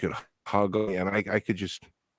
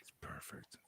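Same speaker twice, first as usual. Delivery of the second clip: whisper; 29 dB quieter than usual. Real, not acted. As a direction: breathe it under your voice. Whispered exchange.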